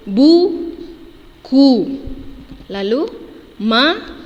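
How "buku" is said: buku said loud and drawn out as an example; teacher showing how buku sounds; clear model word. Shown as a demonstration. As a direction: model buku for young pupils.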